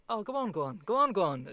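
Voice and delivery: Irish granny urging. Retold or imitated. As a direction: coax them in an Irish accent. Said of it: "they're like".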